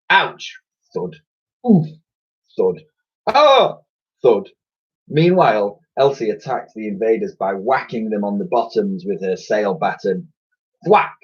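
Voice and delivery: cartoon battle sounds; comic fight noises. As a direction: punch out each hit.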